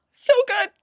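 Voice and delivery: squealed high and bright; fake excitement. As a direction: overplay the joy on purpose. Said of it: "I might have to pretend".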